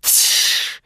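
sharp voiceless hiss. Acted. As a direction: hiss like a striking snake. Venom attacking.

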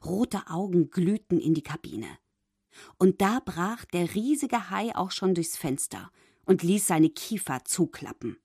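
usual speaking voice

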